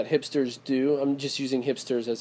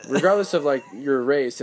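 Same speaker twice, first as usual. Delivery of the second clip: usual speaking voice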